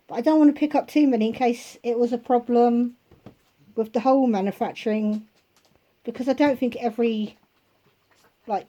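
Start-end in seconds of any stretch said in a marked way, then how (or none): none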